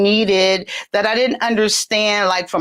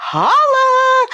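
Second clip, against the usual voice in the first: high, drawn-out call; playful holler; 4 dB louder than usual. Pretend. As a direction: sing it out as a shout-out. Calls out after laughing.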